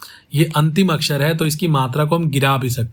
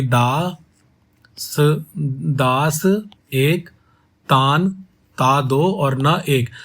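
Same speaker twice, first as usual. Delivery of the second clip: syllable by syllable; much slower than usual. Shown as a demonstration. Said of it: "इसकी मात्रा क्या होगी".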